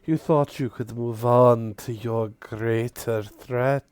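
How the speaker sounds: slow, drawn-out, mocking villain voice; menacing drow matron. Drow matron taunting Varkil from hiding, slow and menacing. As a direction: savor each word like a predator toying with prey. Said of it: "This is Drow".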